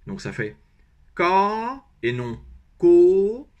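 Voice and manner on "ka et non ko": ka rising, set against ko, very slow. Shown as a demonstration. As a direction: stretch ka slowly upward, not ko. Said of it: "et on monte".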